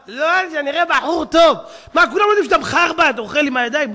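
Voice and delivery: loud, high incredulous challenge; mocking exposure. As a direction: call out the pretence loudly. Mocking someone posing for camera. (קריאת לעג רמה)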